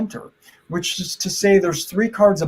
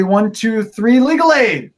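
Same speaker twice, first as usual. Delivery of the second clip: put-on kids party magician; 3 semitones higher, 4 dB louder, slower than usual. Countdown chant of magic word.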